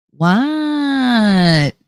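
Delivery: long drawn-out Jersey "what", high arch; exaggerated Jersey-accented "what". Shown as a demonstration. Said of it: "type a Jersey what".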